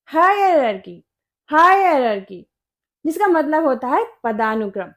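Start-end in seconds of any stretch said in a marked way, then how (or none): 0.1-2.5 हायरार्की as HI-uh-rar-kee, with a K; correct pronunciation. Shown as a demonstration. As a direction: stress HI in हायरार्की, end on kee. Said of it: "इसकी correct pronunciation होती है". (हायरार्की के अंत में 'की')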